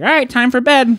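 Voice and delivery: high-pitched mock bedtime call; abrupt, high imitation of a friend; friend announcing bedtime. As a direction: sing it out high, mimicking the kid.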